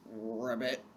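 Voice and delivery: low, soft croaked ribbit, a man faking a frog; odd ribbit voice. Acted. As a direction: play a grown man doing a silly frog call. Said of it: "in a very odd voice".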